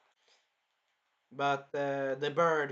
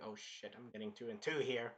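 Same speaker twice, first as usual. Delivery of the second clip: hushed realization; 7 semitones lower, 12 dB quieter than usual. Retold or imitated. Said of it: "and he's like".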